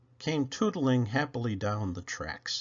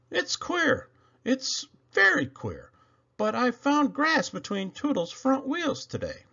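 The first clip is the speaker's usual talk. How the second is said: lightly raised worried voice; puzzled, pitched up a notch; high engineer's voice; 13 semitones higher than usual. A narrator's reading. Worried driver tells Bill about grass, puzzled voice.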